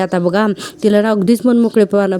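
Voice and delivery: usual speaking voice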